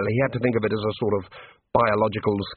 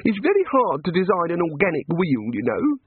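reproachful; 6 semitones higher than usual. A narrator's reading. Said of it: "said the god reproachfully".

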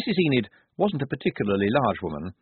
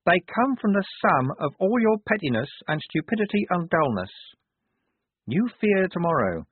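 higher, deliberate, oracular pronouncement; adult and certain; 8 semitones higher than usual. A narrator's reading. Wazza's altered voice tells where abominations come from, certain. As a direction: accuse with absolute, measured certainty. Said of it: "her voice altered, became more adult, more certain".

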